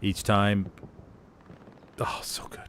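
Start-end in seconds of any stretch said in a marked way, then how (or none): 2.0-2.6 whispered bliss. Real, not acted. Savoring memory of strudel.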